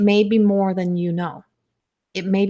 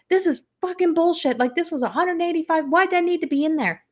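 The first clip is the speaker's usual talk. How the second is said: much higher, indignant protest; retold outrage; 9 semitones higher than usual. Complaining to partner after the capsule, indignant. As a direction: complain in high-pitched, exasperated disbelief.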